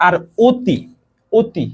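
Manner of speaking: অতি with a closed, rounded অ like ও; model pronunciation. Shown as a demonstration. Teacher models অতি with closed অ for students. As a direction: say অতি with the mouth small, অ sounding like ও. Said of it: "এটা একটু উচ্চারণ করো তো".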